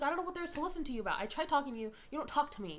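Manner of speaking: high, loud and fast exasperated comeback; exasperated retort. Retold or imitated. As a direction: fire it back at her, fed up. Arguing with roommate at meeting.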